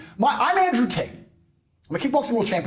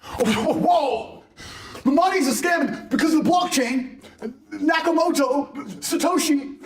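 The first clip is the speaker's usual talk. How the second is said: lampoon a broke crypto fan raving, slow on the names; mocking small-time crypto guy; 8 semitones higher, slower than usual. Mocking a crypto fan with little Bitcoin, high excited shout. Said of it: "Ask someone with one point four Bitcoin".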